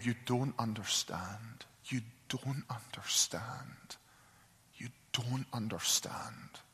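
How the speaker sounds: soft, slow, whispered through tears; broken sobbing. Retold or imitated. Husband breaks down to his wife, tearful whisper.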